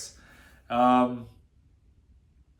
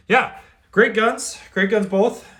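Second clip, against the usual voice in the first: excited; 10 semitones higher, 7 dB louder than usual. Real, not acted. Enthusiastic wrap-up of review.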